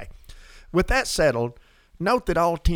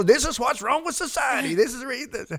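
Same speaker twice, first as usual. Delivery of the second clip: hammed-up indignant rant, much higher; mock rant; 10 semitones higher than usual. Host pretending Jesus ranted, in a mock indignant rant. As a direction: play a pretend grumbler railing at society.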